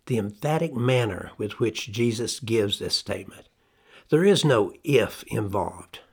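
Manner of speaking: ordinary delivery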